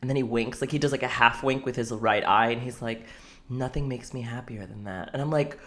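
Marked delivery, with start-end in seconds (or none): 3.5-5.1 low, hushed, seductive; sultry voice. Retold or imitated. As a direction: purr it softly. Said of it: "weird sultry voice".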